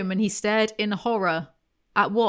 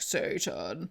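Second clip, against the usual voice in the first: low, soft demonic voice; impersonated devil, low and drawn-out; imitating Satan; 9 semitones lower, 7 dB quieter than usual. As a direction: drop deep and quiet for a mock devil. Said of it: "Satan is like".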